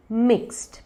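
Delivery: मिक्स्ड as one syllable, "mikst"; correct pronunciation. Shown as a demonstration. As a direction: end मिक्स्ड on a crisp t. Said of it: "ये है मिक्स्ड". (मिक्स्ड को "मिक्स्ट" बोलें)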